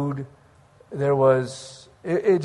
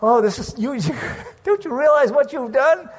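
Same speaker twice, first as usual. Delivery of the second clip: exasperated outburst; 7 semitones higher than usual. Retold or imitated. Retelling his own upset reaction.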